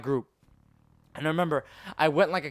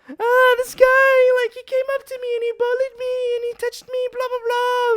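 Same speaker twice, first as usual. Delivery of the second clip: very high squealing imitation of a crying girl; mock crying; 5 dB louder than usual. Crying girl telling her boyfriend about a bully, mock high wail. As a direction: whine tearfully in a put-on falsetto. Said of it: "she was just crying there".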